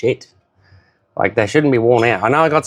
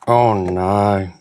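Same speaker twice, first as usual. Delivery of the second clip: ordinary delivery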